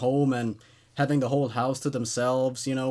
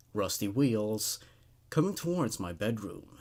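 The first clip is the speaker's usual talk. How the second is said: low, hushed horror storytelling; creepy story narration; 4 semitones lower than usual. A narrator's reading. Narrating a creepypasta to viewers in a hushed, creepy read.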